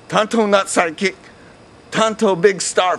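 high, indignant pidgin boast; proud Tonto. A narrator's reading. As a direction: puff up and insist you're the star. Tonto voiced in parody script.

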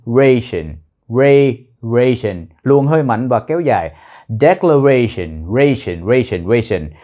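nhấn mạnh và kéo dài âm "ra" trong "ration"; stress on RA. Shown as a demonstration. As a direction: push a long breath into "ra" of "ration". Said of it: "Nhấn vào chữ re".

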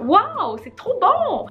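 plus aiguë, lente, émerveillée; voix d'enfant ravie. Retold or imitated. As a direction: joue la petite qui adore ça. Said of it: "Moi, je suis comme". (imitation de la fillette ravie, plus aiguë)

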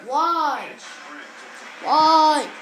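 loud drawn-out wail, building on the repeat; real gamer's protest; exasperated cry. Real, not acted. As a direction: cry out in dismay at the game. Dismay at game play.

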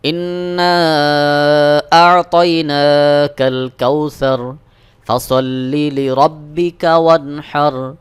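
slow melodic tajweed chant; ধীর সুরেলা তিলাওয়াত; Quran recitation. Shown as a demonstration. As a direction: draw out every vowel in recitation. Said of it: "পুরো তিন আয়াত একসাথে পড়ব".

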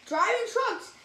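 lively, sing-song storybook reading; reading to children. A narrator's reading. Reading a rhyming picture book to kids, animated. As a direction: read it like bedtime for little ones.

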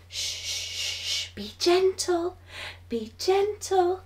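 soft hushed be gentle. Acted. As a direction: hush it down for the sleeping baby. Putting baby down to sleep.

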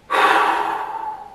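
long airy exhale; tired heavy sigh; breathless sigh. Real, not acted. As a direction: blow the breath out, spent. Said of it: "I'm here panting".

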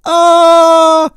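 long, loud, high scream; drawn-out high cry of dismay; frustrated scream. Real, not acted. Scream after failing.

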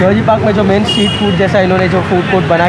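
muffled behind mask; covered mouth speech; through a face mask. Real, not acted.

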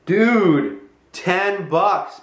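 loud, high acted-out amazement; amazed. Telling how he found ten bucks, amazed. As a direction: draw out the amazed reaction, loud.

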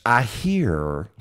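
light imitation of an old Alabama friend; imitating Norman Holland. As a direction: drop into a friend's drawling voice, lightly. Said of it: "that's me imitating my old friend Norman Holland".

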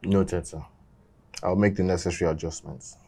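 low, soft, dutiful acknowledgement; subdued compliance. Acted. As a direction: give in quietly, voice dropped low. Scolded son gives in to elder.

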